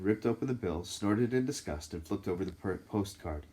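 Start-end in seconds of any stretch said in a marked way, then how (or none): none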